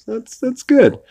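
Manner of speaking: normal talking voice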